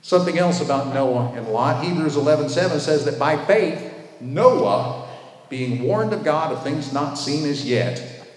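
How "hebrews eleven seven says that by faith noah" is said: ordinary talk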